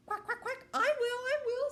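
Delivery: very high, excited quacking duck; eager storybook duck. A narrator's reading. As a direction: pipe up loudly as an eager duck. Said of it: "said the duck".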